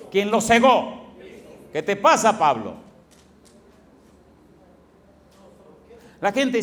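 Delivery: loud rising pulpit questions; preacher building to full force; fiery preaching. Real, not acted. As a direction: hammer each question at the congregation.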